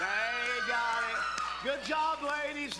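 loud, high cheering praise; excited shout. Real, not acted. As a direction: cheer the winners loudly. Announcing girls won cheer contest.

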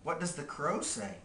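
usual speaking voice

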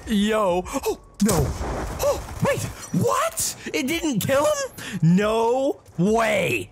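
high-pitched, incredulous outcry; shocked disbelief. Real, not acted. Gamer whose hit didn't kill, shocked disbelief. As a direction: squeak it out in real disbelief.

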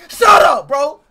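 high, loud yell; shouted interruption. Real, not acted. Streamer cutting off a chanting fan, shouted. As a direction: shout over the other person.

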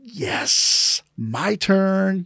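high, breathy mock-eager "yes, my turn". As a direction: pounce on your turn like a kid. Eager to fight, acted quote.

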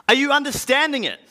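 high, quick call from the road; shouted question. Acted. Apostle calls to a man in a passing chariot, shouting. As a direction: call it out briskly, pitch jumping well up. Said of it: "I shouted from the road".

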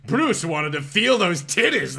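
plain reading voice

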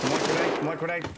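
loud urgent grenade warning; grenade warning yell. Real, not acted. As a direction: call it out sharply to the team. Warning teammate of his grenade.

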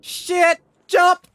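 panicked yells, high and loud; alarm shout. Real, not acted. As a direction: shout the warning in sudden panic. Sudden danger in game, yelling.

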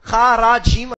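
"خا، را، جیم" said loud, each letter set apart; loud deliberate letter-by-letter spelling; spelled letter by letter. Shown as a demonstration. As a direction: spell the root out to the class. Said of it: "spelling out root letters".

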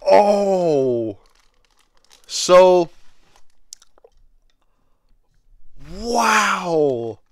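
high, drawn-out exclamations of wonder; awed amazement. Real, not acted. Collector unboxing a long-wanted figure, awed. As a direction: let the wow stretch out, awestruck.

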